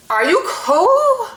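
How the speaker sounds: high, drawn-out disbelief; high-pitched, slow, incredulous question. Real, not acted. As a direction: stretch the last word up high in mock disbelief. Incredulous reaction to the quote.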